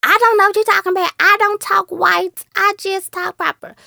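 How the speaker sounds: high, prim voice of denial; imitating someone who insists they talk proper; mock proper talker. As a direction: put on a high-pitched proper voice, mockingly. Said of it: "for people that's still like".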